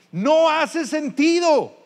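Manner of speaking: "No" punched high and loud, the whole phrase very slow; stress on No. Shown as a demonstration. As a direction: lean hard on "No", drawn out and loud. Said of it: "la viuda pobre ... echa todo su sustento". ("No" muy acentuado, fuerte, agudo y lento)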